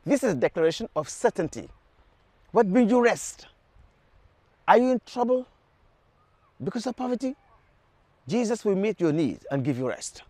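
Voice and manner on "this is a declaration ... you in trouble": ordinary delivery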